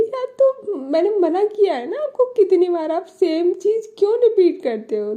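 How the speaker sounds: high, exasperated scolding; fed-up girlfriend telling her off; irritated complaint. Retold or imitated. (झुंझलाकर ऊँची आवाज़ में)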